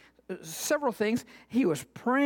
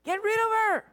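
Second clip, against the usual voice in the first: a higher, impatient get rid of her; disciples complaining; 9 semitones higher than usual. Acted. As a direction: brush her off.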